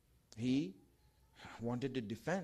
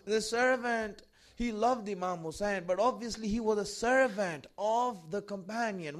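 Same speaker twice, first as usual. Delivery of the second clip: loud, high, full-force sermon, rising emotion; impassioned preaching; 9 semitones higher, 7 dB louder than usual. Acted. Preacher telling the martyrs' story to the gathering, impassioned and loud. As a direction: preach it out to the mourners with fervour.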